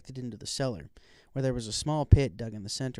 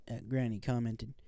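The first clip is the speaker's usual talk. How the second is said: usual speaking voice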